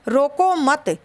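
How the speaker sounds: "रोको मत" said as one joined phrase; no break before "मत", pause right after it; comma after मत. Shown as a demonstration. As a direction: run "रोको" straight into "मत", then stop. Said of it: "यहाँ हमने coma यानी कि जो अल्प विराम है".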